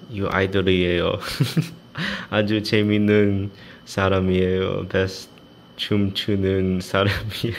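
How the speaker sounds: loud, fast gleeful boasting; loud hype. Real, not acted. Reading praise about himself, loud and gleeful. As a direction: belt out the praise like a hype man.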